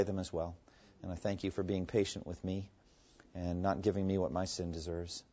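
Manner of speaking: hushed, earnest prayer; closing prayer. Real, not acted. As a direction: pray humbly to close the study.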